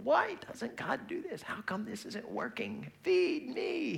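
very high, slow whiny wail; mock screaming baby. Preacher playing himself as a baby complaining to God, high mock wail. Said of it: "I'm more like the screaming baby".

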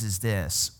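normal talking voice

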